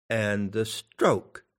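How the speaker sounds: measured, deliberate recitation; slow verse reading. Shown as a demonstration. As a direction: read "and the stroke" slowly, like a line of poetry. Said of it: "I'll give you an example of one".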